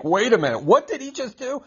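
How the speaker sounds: high-pitched double take; mock-incredulous, high-voiced question; startled listener. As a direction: act out a taken-aback bystander, pitch leaping up. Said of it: "teach in ways like".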